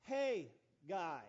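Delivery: Haggai split into "Hey, guy"; joke pronunciation of Haggai. Shown as a demonstration. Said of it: "alternate translation that you can pronounce it".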